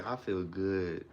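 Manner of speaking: mumbled, lifeless affirmation; low monotone. Shown as a demonstration. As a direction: say it like nothing matters. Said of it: "say it in a low monotone".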